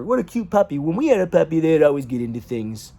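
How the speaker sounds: light high sing-song voice; a hint of a gushing sister; mocking sister. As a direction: mimic the sister's chirpy chatter. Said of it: "just like my sister".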